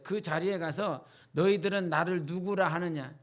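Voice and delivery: ordinary talk, nothing marked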